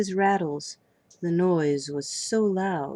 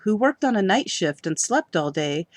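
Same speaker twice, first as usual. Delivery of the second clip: plain reading voice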